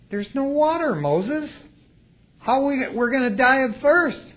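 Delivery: whiny complaint. Retold or imitated. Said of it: "Israelites voiced complaining".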